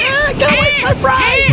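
high screechy seagull yell. Retold or imitated. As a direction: shriek it like a greedy gull. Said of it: "You're yelling at the same".

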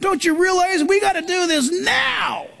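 congregant's exasperated shout; exasperated outburst. Retold or imitated. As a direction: blurt it out at the pastor. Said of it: "I wanted to say to you".

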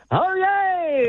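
high, ringing, excited call; excited town crier demo. Shown as a demonstration. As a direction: show him how it's done with flair. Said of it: "So it has to be".

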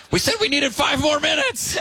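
high indignant complaint. Retold or imitated. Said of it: "they're like".

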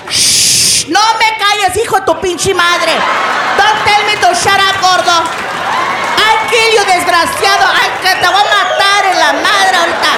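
hammed-up threats to sound like a fight; mock angry quarrel. Friends faking a hallway fight, mock furious.

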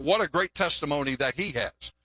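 normal talking voice

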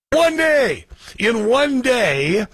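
high, stretched "one day" in disbelief; incredulous. Real, not acted. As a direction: drag out "In one day" amazed. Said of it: "outraged repeat".

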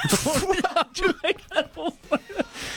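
high embarrassed laugh. Real, not acted. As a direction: laugh at your own awkwardness.

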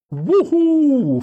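high, loud whoop of delight; sudden gleeful cry in the dark; excited whoop. A narrator's reading. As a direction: whoop it out like a joyride yell. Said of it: "fece Dean".

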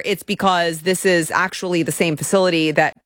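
ordinary talk, nothing marked

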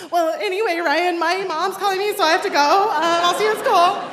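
acting brave while about to cry; holding back tears. Crushed girl hurrying off the phone, holding back tears.

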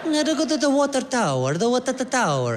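lost Italian tourist asking the way; Italian tourist asking directions, light accent. Retold or imitated. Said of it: "cute little Italian couple that's like".

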